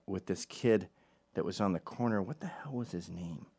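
ordinary talk, nothing marked

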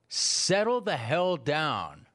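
drawn-out "Settle the hell down"; scolding the producer to calm down; slow stern command. Real, not acted. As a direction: stretch out every word as a warning.

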